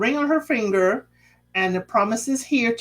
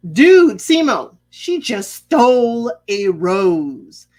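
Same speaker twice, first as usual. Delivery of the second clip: exasperated call out; 2 semitones higher, 7 dB louder, slower than usual. Real, not acted. Vlogger calls out to Simo.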